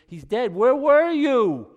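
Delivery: high, loud wailing question; anguished cry. Acted. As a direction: cry out in grief to Jesus. Martha crying to Jesus.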